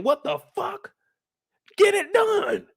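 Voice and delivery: panicked royal yelling orders; frantic king. Retold or imitated. King demanding the birth be handled, high frantic yell.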